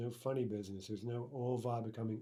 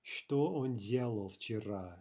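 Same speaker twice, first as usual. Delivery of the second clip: slow, clear Что он делал вчера; slow dictation-style read of Что он делал вчера; slow model sentence; slower than usual. Shown as a demonstration. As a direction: spell it out slowly for the class. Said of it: "how would we translate".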